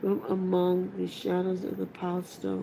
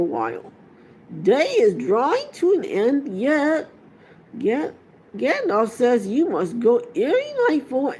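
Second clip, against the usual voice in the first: put-on higher, louder booming old Ent voice; Treebeard; 7 semitones higher, 7 dB louder than usual. A narrator's reading. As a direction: ancient Ent saying farewell, unhurried and kindly.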